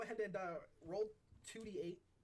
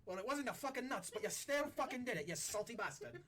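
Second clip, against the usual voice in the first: higher, rowdy pirate cursing; sore-losing pirate swearing at a card winner; rough pirate grumble; 7 semitones higher than usual. Acted. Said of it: "They're like".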